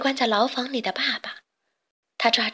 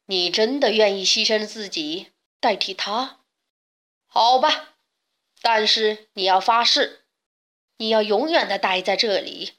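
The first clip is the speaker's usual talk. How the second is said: hesitant beast, then firmly setting terms; 野兽犹豫. A narrator's reading. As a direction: voice a storybook beast weighing a bargain. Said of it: "他开始犹豫了". (野兽先迟疑，再郑重提出条件)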